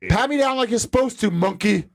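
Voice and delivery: high-pitched mocking order; retold taunt. Retelling his order to the screener, taunting. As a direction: jeer it as a command, pitched well up.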